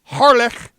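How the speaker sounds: "Harlech" high, ending in a guttural Welsh "ch"; careful Welsh pronunciation. Shown as a demonstration. Newsreader attempting a Welsh town name, "Harlech" pronounced with a guttural ch. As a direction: stress "Harlech" with a throaty final ch, Welsh-style. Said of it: "I believe I'm pronouncing that right".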